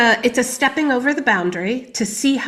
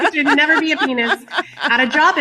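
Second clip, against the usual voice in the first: laughing through the words, fast and higher; 7 semitones higher, 5 dB louder, faster than usual. Real, not acted. Guest laughing at her own dark joke, laughing. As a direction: giggle it out while making the point.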